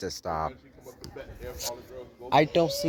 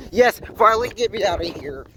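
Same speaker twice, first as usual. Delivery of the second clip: excited relief; 5 semitones higher, 3 dB louder than usual. Real, not acted. Fire drill finally over.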